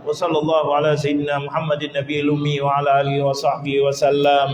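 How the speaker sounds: rapid, rote recitation of the closing prayer; quick murmured Arabic prayer; fast Arabic recitation. Real, not acted. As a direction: rattle off the memorised Arabic doa.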